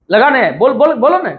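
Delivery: ordinary talk, nothing marked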